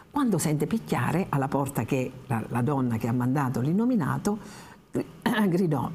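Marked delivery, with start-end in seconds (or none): none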